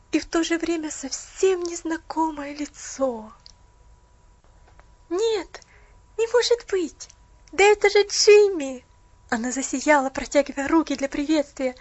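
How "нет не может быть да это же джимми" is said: high, excited cry of recognition; joyful surprise. Acted. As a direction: gasp with delight at a familiar face. Joyful recognition, higher and louder.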